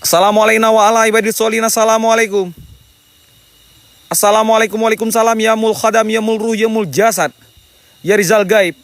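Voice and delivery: high, loud chanted invocation; loud invocation to the unseen; summoning call. Real, not acted. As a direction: call the spirits out with a ritual greeting.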